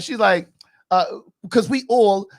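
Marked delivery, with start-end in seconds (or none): 1.5-2.3 brief mock New England accent; a quick stab at a New England accent. Podcaster imitating a castmate when asked, light New England accent. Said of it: "she got this New England accent".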